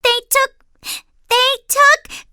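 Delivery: high, loud, choked with anger; too angry to get the words out; sputtering angry. Acted. Upset little sister stammering to Mama. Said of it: "so angry she could hardly speak".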